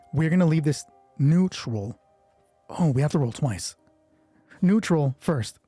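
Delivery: ordinary talk, nothing marked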